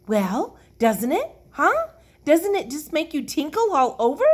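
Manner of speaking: high, loud, rising questions; insistently excited. A narrator's reading. Lucille presses the silent children, eagerly insistent. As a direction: press them for an answer, pitch climbing.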